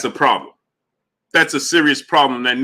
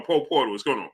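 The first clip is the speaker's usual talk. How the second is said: ordinary talk, nothing marked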